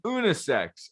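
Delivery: "unisex" said "oo-nee-sex"; mocked pronunciation. Host repeating his friends' word, "unisex" as "oonee-sex". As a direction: mimic "unisex" with an "oo" at the start. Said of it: "pronunciation of unisex".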